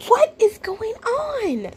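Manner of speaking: high, drawn-out baffled question; exasperated disbelief. Real, not acted. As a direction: stretch it out in high-pitched bewilderment. Baffled reaction to video.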